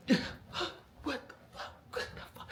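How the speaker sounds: whispered, gasping disbelief; stunned disbelief. Retold or imitated. Recruit reacting to the haircut, stunned whisper. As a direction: mouth it in breathless shock.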